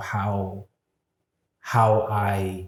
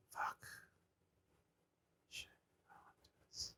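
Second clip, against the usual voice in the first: barely audible frightened whisper; scared whisper; 27 dB quieter, faster than usual. Retold or imitated. The scared inner voice, whispering. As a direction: breathe it out in fear. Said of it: "I have two voices".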